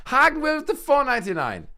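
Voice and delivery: lightly high squeaky donor thanks; high toy voice reading a donation; high cartoon voice. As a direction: keep the critter voice for the thanks.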